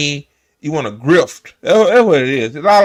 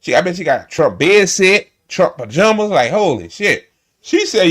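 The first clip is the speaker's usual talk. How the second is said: normal talking voice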